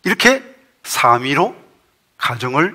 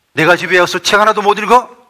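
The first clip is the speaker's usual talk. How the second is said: louder, higher outburst of a husband's anger; angry shout; 6 semitones higher, 7 dB louder than usual. A narrator's reading. Husband blows up at his wife over his book, angry shout. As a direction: read it as an indignant, shouted question. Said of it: "소리치면서".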